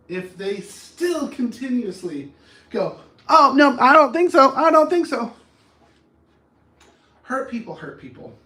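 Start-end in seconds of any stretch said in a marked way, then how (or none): 3.3-5.3 high, very loud protest; acted indignant yelling; loud refusal. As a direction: shout them down, refusing. Acts someone refusing to let go.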